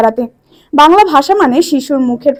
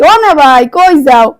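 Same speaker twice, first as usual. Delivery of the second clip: "ও না ভাই, কই যাও" said in Barishal dialect, a bit louder; 4 dB louder, slower than usual. Shown as a demonstration. Speaker demonstrates to judges "ও না ভাই, কই যাও" in Barishal dialect. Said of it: "এইটা হইলে মগো বরিশাল্য ভাষা".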